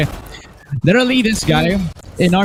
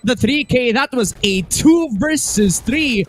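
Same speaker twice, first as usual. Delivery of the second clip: esports caster; 7 semitones higher than usual. Acted. Live play-by-play.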